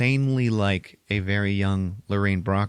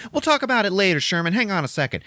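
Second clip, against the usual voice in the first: high-pitched schoolgirl voice, louder; brisk brush-off; 12 semitones higher, 4 dB louder, faster than usual. Acted. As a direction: put him off in a hurry. Girl brushing off the bookworm.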